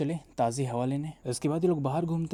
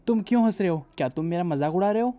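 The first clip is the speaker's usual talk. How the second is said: higher and louder, offended; indignant outburst; 4 semitones higher, 7 dB louder than usual. Acted. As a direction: snap back, hurt and indignant. Girlfriend offended at his laughing. (नाराज़ होकर ऊँची, तेज़ आवाज़ में)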